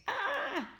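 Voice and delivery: very high falling scream. A narrator's reading. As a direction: scream plummeting down. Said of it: "the boy screamed".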